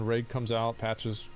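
ordinary delivery

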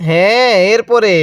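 usual speaking voice

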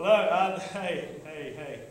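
ordinary delivery

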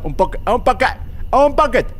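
climbing to a high, loud squeal; playful urging. Pretend. Driver inching the car forward, playfully urging. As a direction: nudge it along in a silly, rising voice.

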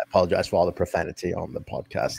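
low, hushed British politeness; put-on British voice, soft and low; soft-spoken British accent. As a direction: apologize like a meek British gentleman. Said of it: "very soft-spoken guy in person with a British accent".

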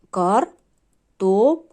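slow «картопля», broken into three syllables with clear pauses; по складах. Shown as a demonstration. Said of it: "Три склади". (вимовити «картопля» повільно, по складах, з паузами)

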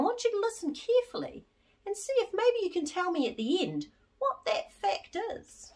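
unvoiced secret whisper; hushed, excited whisper. Real, not acted. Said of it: "excited secretive aside".

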